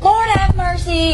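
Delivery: high, slow Southern drawl; Southern grandma. Acted. Guest imitates own grandma, Southern grandma drawl. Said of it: "my grandma's so Southern. She's always like".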